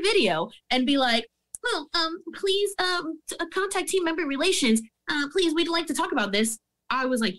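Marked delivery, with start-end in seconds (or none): 1.6-6.6 high, sugary customer-service voice; prissy HR rep, mocked; mock corporate voice. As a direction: parrot the company's polite reply. Mocking corporate reply.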